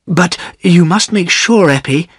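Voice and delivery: put-on fretting for the girl's future; a worried old weaver. A narrator's reading. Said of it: "said Silas worriedly".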